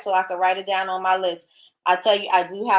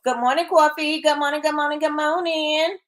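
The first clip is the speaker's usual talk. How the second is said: sing-song greeting; 7 semitones higher than usual. Real, not acted. Greeting a viewer joining the live.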